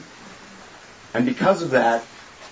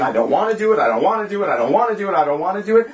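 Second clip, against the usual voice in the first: whiny complaint; 5 semitones higher, much faster than usual. Retold or imitated. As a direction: whine it like a stubborn child. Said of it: "He's letting you talk".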